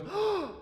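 sharp high gasp of fright; startled gasp. Acted. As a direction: gasp as if seeing a ghost. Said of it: "one of them just goes".

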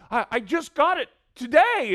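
high, loud wail of dismay; mock-anguished, stuttering high protest; imitated distraught stammer. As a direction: mimic a heartbroken car owner. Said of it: "He started to stammer".

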